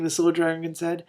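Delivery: usual speaking voice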